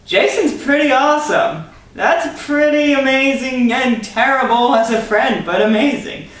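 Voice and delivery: very high, prissy villain voice; a fussy, snooty villain laying down the terms. Acted. Unknown ranger giving Jason a cruel choice, high prissy voice. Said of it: "the unknown ranger said".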